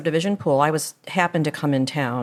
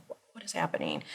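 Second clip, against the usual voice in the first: voiceless, much softer question; stunned whisper; 12 dB quieter, faster than usual. Retold or imitated. Mother alone in her car at roadside, whispering. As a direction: whisper it in retold bewilderment.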